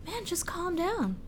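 high, soft, slow half-whisper; mock soothing. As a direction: imitate someone smoothly calming another down. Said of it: "he was going".

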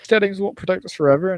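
rally them with mock passion; a hammed-up impassioned plea; impassioned speech. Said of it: "he gives this whole impassioned speech".

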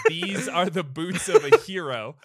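loud, high, laughing mock mother voice. Retelling the movie, voicing the mother, laughing loudly. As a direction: cackle through the mother's proud line.

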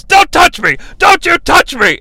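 high, loud angry shouts; mock yelling. Graham storming off, high mock yell. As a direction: snap it like a furious wrestler.

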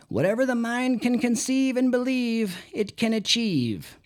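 high whiny impression of Napoleon Hill; high-pitched whiny voice. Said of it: "kind of high-pitched, whiny voice".

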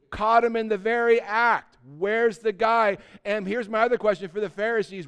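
loud, high, driving sermon voice; fired-up preacher calling out the older folks; preaching at full force. Acted. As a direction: pound it home to the congregation.

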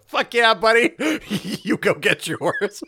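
cheering through laughter; cheering the floating preacher, laughing. Retold or imitated. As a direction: whoop him off while cracking up. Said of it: "floats upward like".

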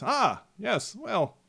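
high, quick flustered gnome; startled gnome brushing off the bird, high and quick. Acted. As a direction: jump and bluster it out. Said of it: "the gnome just kinda jumps and says".

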